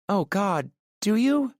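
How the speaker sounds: high, rising mock alarm; mock-shocked woman. A narrator's reading. Aurora reacts to his joke with high mock alarm. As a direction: squeal it in playful horror.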